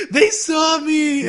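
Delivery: high, loud, drawn-out mock wail; hammed-up panicked cry, stretched right out; freaked-out scream. Retelling Megu realizing they saw him, hammed-up freaked-out scream. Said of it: "he freaks out, and he just screams".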